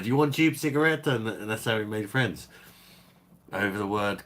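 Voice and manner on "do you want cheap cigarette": high, fast mock Polish accent; hammed-up Polish accent offering cheap cigarettes; imitating Polish coworker. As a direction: pitch way up and rush it like the Polish workmate. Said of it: "And he's like".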